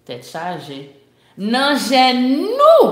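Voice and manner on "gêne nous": "nous" high, loud and held long; slow build to a loud, drawn-out "nous"; stress on "nous". Shown as a demonstration. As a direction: punch "nous" and stretch it out. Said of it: "elle a mis l'accent tonique sur le mot nous".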